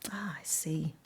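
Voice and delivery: soft whispered realisation. Real, not acted. Reader understanding Rusty's search, whispered.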